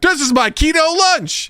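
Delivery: high, slow, smug mock boast; mock proud. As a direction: show off your fake keto lunch. Mocking fake keto dieter.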